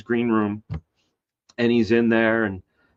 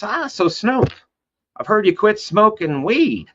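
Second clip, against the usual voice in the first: much higher, mock host voice; talk show host; 10 semitones higher than usual. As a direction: play the sly TV host. Jay teasing on air.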